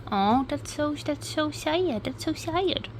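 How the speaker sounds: high, soft, pitying little voice; saddened. Real, not acted. Reacting to news of a fatal stabbing, saddened.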